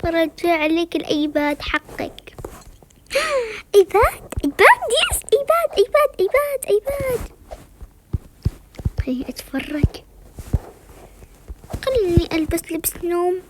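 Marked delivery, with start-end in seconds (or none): 3.1-3.7 sharp high gasp of delight. Real, not acted. Girl seeing her iPad, gasping. 3.7-7.2 high, squealing excitement; excited. Real, not acted. Girl overjoyed to have her iPad, squealing. 9.1-10.0 secret whisper. Real, not acted. Girl sneaking screen time, whispered.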